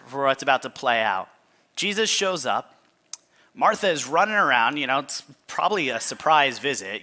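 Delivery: usual speaking voice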